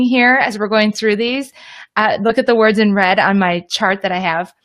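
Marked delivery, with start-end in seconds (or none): none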